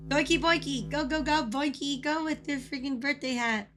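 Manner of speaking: ordinary delivery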